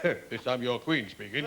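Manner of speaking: slow, lightly voiced mock royal broadcast; a hint of the Queen's speech, drawn out; queen impression. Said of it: "Put your wireless on Christmas Day".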